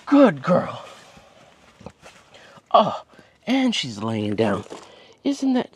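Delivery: high squeaky praise for a pet; squeaky praising a dog. Real, not acted. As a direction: coo praise at the puppy.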